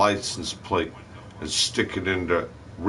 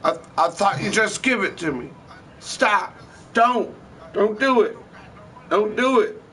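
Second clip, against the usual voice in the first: much higher, stammering, terrified begging; panicked pleading; 12 semitones higher than usual. Retold or imitated. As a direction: stutter and plead like a gun is on you. Robber begging not to be shot.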